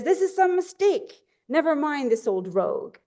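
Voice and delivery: high-pitched mock indignation, easing down; mildly upset. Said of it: "he's very upset".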